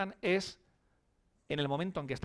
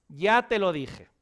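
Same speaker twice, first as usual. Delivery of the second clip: slow, louder I-told-you-so mother; mock mother's reproach, slow and louder; reproachful told-you-so; 4 semitones higher, 8 dB louder, slower than usual. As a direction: scold the crying child like a smug mum. Mother scolds child who returns crying.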